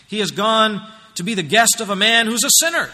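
grumbling disapproval. Acted. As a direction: act out a disapproving onlooker. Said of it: "They all began to grumble".

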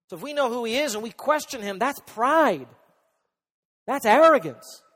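high, loud, indignant pulpit voice; full-force preaching. Real, not acted. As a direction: thunder it at the congregation.

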